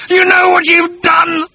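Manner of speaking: slow, high, loud agonised cry; pained gurgle. Acted. Agrajag dying and blaming Arthur, pained high cry. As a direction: gurgle it out in pain. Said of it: "he gurgled painfully".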